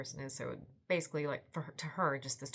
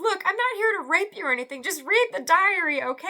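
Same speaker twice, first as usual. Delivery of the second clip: much higher, louder, fast guy voice; hammed-up stranger; 13 semitones higher, 15 dB louder, faster than usual. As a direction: rush the reassurance, loud and high. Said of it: "he goes".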